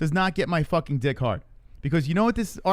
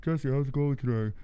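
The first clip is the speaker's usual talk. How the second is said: muffled talk through a face mask. Acted. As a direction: talk to a friend from behind a mask. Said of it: "your voice sounds different with the mask on".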